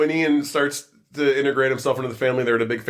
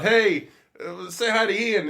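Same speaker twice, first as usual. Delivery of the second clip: higher mock yell across the room; 9 semitones higher, slower than usual. Brother calling to his cousin at the party, mock yell. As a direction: call out across a party, half-hammed. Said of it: "yells out".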